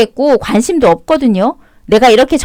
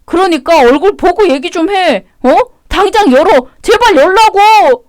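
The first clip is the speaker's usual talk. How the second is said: pleading demand; 6 semitones higher than usual. Acted. Husband banging on the door.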